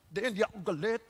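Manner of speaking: very soft, suppressed denial; mock denied anger. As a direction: keep it tiny, pretending not to be angry. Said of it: "hindi sumisigaw".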